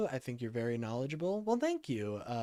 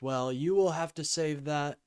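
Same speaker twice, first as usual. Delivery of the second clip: normal talking voice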